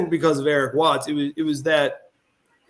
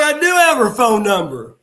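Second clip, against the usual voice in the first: excited; 7 semitones higher, 6 dB louder than usual. Retold or imitated. Excited reply retold.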